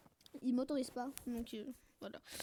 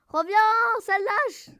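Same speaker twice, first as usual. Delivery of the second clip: loud, higher yell; raised voice in mid-fight; calling out; 7 semitones higher, 9 dB louder, much slower than usual. Real, not acted. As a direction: shout after someone getting away. Yelling after an enemy mid-fight.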